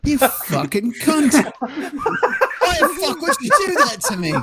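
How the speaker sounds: high, drawn-out cursing through laughter; laughing mock outrage. Real, not acted. Co-host falls for a fake sign-off, laughing in mock outrage. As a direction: curse them out while cracking up.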